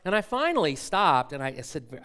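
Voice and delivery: ordinary delivery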